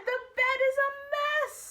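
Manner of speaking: high and loud mom voice; mom's exclamation. Shown as a demonstration. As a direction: sound like an exasperated mom. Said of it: "Can you hear Mom saying that in your head?".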